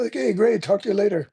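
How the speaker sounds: mock the careless friend brushing him off, airy and high; mock breezy brush-off. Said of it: "mocking the careless reply".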